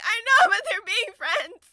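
high, loud laughing protest; defending her tears while laughing; laughing squeal. Real, not acted.

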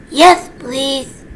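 ordinary delivery

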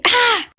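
short high-pitched scream; panicked shriek. Acted. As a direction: shriek in alarm at being late. Acted panic on waking late.

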